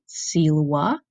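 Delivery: silwa with W sound for V. Shown as a demonstration. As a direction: say silwa as sil-wa, V as W. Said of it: "You can hear I'm pronouncing that V like a W".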